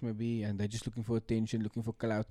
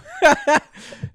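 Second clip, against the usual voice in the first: loud high laugh; 12 dB louder than usual. Real, not acted. Reacts to yawning joke.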